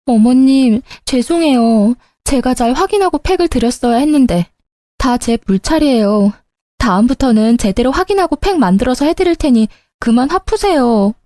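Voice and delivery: put-on weepy daughter-in-law; pretend crying. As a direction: plead as if close to tears. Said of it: "우는 척 연기했죠".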